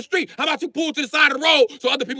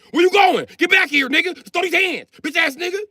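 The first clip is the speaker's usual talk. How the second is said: retold yell; 6 semitones higher than usual. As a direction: call the driver back with a raised yell. Yelling at the departing truck.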